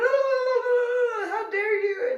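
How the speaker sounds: high, loud wailing gibberish tirade; hammed-up high-pitched yelling; mock yelling. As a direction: screech like an outraged grandma. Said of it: "started yelling in Spanish".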